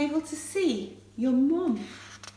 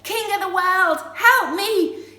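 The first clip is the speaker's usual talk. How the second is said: loud, high-pitched plea; raised-voice cry for help; 8 semitones higher, 7 dB louder than usual. Shown as a demonstration. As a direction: call out boldly for rescue. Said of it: "cry out".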